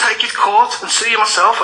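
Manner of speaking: ordinary talk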